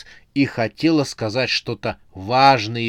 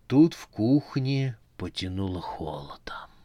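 ordinary delivery, nothing marked

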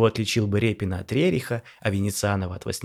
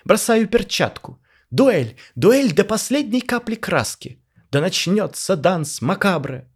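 very high, slow, pompous declaration; grand challenge; 10 semitones higher, 6 dB louder, slower than usual. A narrator's reading. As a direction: throw down the gauntlet with ceremony. Pyotr challenges to a duel.

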